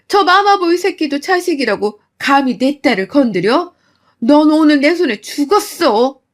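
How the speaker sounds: mother confronting the mother-in-law in rage; furious mother. Acted. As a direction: tear into her daughter's abuser.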